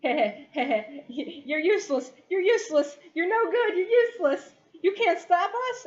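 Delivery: high, loud mocking shrieks between laughs; laughing taunt. Beaten thug laughing at Shay, high cackling taunt. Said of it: "he's, like, laughing and is like".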